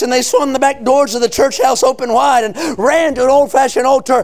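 driving, sustained revival sermon cadence; full-force preaching. Real, not acted. Preacher proclaiming salvation to the congregation, full-force preaching. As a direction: preach it hard, stretching the key words.

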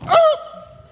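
high loud mock horse shriek. Horse stopping short at the stable, high loud shriek. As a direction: shriek like a horse pulling up hard. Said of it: "He just goes".

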